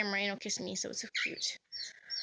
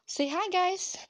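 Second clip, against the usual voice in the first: bright squeal of hi, much higher and louder; excited high greeting; 9 semitones higher, 5 dB louder, slower than usual. Real, not acted.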